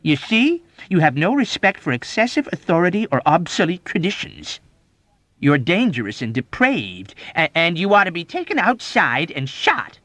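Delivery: high, loud denunciation; outraged, emphatic condemnation; ranting. A narrator's reading. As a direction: denounce him in a mounting tirade. Major's tirade against patient.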